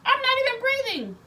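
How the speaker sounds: very high and loud, half-wailing; mock panicked shout back at the nurse; frantic shout. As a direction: cry it out like you're panicking. Retelling crying during the exam.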